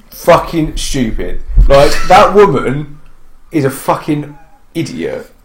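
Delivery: ordinary talk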